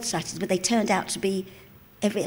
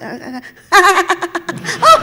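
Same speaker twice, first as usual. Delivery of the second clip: loud high cackling laugh; loud high showpiece cackle; her famous laugh; 11 semitones higher, 10 dB louder than usual. Shown as a demonstration. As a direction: let the big laugh rip on demand. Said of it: "can I hear that laugh".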